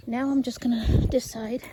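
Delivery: ordinary talk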